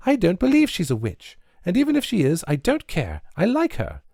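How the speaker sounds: a touch of tears in a high protest; sobbing protest. A narrator's reading. Nancy defending the witch, high tearful protest. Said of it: "in a voice all broken with sobs".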